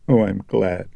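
sniffling. A narrator's reading. Chris after crying, blowing her nose, sniffling. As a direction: say it through the last of the tears. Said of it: "sniffling and blowing her nose".

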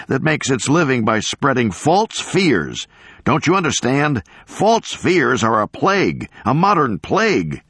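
high-pitched, impassioned tirade; agitated professor hammering out accusations; ranting denunciation. Acted. Professor denouncing a man before the camera crew, ranting.

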